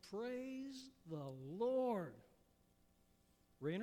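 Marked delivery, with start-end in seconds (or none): 0.1-2.1 long drawn-out high cry of praise; yelled praise. Acted. Old woman on her porch yells to the sky. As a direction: stretch it out, arms raised to the sky. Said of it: "she would yell".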